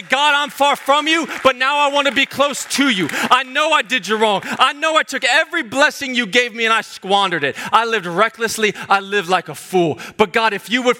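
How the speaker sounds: high, pleading prayer, lightly acted; a prodigal begging to come home; lightly voiced repentant child. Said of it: "to say".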